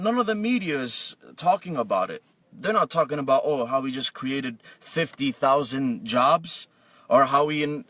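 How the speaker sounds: normal talking voice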